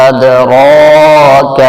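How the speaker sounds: ادراک with the first vowel stretched very long; long madd. Shown as a demonstration. Teacher stretching ادراک for a full madd. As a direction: hold the vowel in ادراک for five counts. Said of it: "پانچ انگلیوں کو برابر کھینچ کے".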